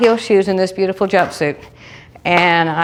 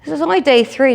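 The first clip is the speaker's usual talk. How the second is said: high self-talk; 7 semitones higher than usual. Retold or imitated. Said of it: "And I'm like".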